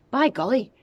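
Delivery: put-on boy amazed by the smoke; startled. A narrator's reading. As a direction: gasp it out in shock. George startled.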